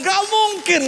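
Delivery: high, loud retold disbelief; disbelieving doctor. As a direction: exclaim like an astonished doctor, imitated. (seruan tak percaya, menirukan dokter)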